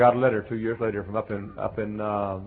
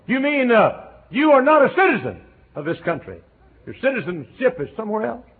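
high, loud questioning; puzzled man; 12 semitones higher, 8 dB louder than usual. Retold or imitated. Chemist puzzling over the preacher's citizenship, high and loud. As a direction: ask in surprise.

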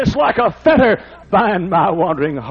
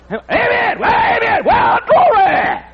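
high, loud shouts of amen and glory; mock shout; 4 dB louder, slower than usual. Preacher mimics the shouting crowd, loud mock shout. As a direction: bellow it like a worshipper carried away.

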